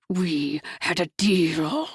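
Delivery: low, slow growl of a betrayed man; growled line. A narrator's reading. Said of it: "he growled".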